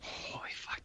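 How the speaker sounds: breathy whispered oath; whispered awe. Real, not acted. Cohost reacting to the horizon line, awed whisper. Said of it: "whispered awe".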